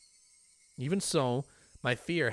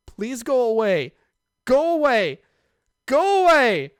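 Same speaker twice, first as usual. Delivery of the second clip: much louder, higher panicked shouts; screaming; 12 semitones higher, 14 dB louder, slower than usual. Acted. Keiichi screaming at Rena to go away. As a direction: scream at her in terror.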